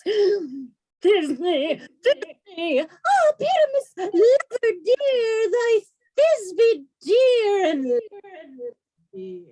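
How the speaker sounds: high, slow, hammed-up falsetto; monstrous little voice. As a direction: squeak it out like a lovesick maiden. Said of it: "I'll speak in a monstrous little voice".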